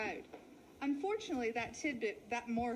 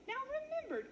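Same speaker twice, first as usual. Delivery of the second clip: high, pointed warning to his partner; a sly wolf hushing his brother; cautioning wolf; 13 semitones higher, much faster than usual. Acted. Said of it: "cautioned Willie to Wally".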